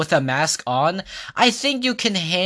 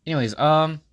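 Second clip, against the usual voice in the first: ordinary delivery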